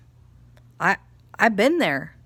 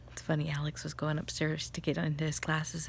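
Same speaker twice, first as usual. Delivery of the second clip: normal talking voice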